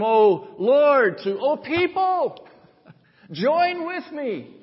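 high, loud, rousing cry; exultant call. Acted. Psalmist calling the people to join him, exultant call.

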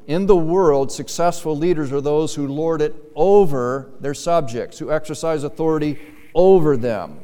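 "over" hit hard and loud in both places; stress on over. Shown as a demonstration. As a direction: preach it loudly, leaning heavily on "over". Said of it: "Notice Jesus' emphasis on the word over".

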